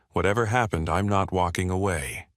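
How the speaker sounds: plain reading voice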